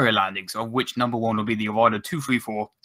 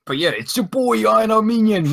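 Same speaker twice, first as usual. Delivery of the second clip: higher, louder hype-man intro; hyped self-intro; 10 semitones higher, 5 dB louder than usual. Pretend. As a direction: introduce yourself like a big star.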